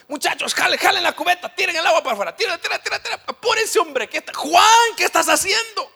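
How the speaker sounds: high, loud barking orders at the crew; bossy Peter in the storm. Retold or imitated. Peter ordering the disciples to bail water, bossy shouting. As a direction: yell commands over the storm. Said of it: "yo me imagino a Pedro en el barco".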